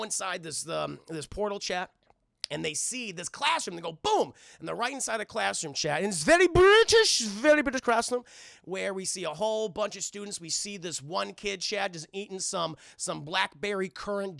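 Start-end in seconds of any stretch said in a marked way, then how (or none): 6.2-8.2 high, loud mock British; mock British accent. Storyteller introduces a British classroom, loud high mock British accent.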